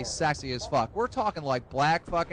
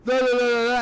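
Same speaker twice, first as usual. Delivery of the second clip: mock angry gibberish rant, raised and loud; pissed-off jabber; 6 semitones higher, 6 dB louder than usual. Boss raging at worker who quit, mock furious babble. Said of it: "he got real fucking pissed off at me".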